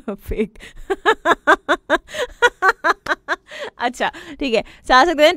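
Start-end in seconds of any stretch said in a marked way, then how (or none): 0.8-3.7 bursts into high giggling laughter; real amused laugh, rising high. Real, not acted. Laughing at a listener's prank confession on air, high giggle. RJ laughs at fake currency joke.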